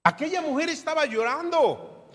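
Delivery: ordinary talk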